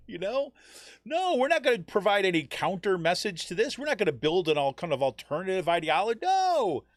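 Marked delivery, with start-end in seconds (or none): 1.1-6.8 loud, high-pitched sarcastic protest; hammed-up, raised-voice parody of refusing; mock refusal. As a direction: ridicule the do-nothing stance, building to an exaggerated high NO. Mocking refusal to counter extremists.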